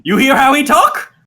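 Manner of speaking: high, loud goblin squawk; high-pitched, emphatic little goblin. Acted. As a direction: exclaim shrilly as a goblin. Same goblin keeps talking.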